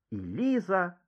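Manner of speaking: plain reading voice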